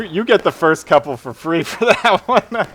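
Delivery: ordinary talk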